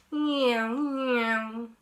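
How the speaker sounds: high, loud playful meow; child's loud meow. Acted. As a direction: cry it out like a toy plane. Baby Bear playing aeroplane.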